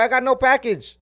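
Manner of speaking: hammed-up retold neighbor with a put-on voice; imitating the neighbor lady. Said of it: "She said".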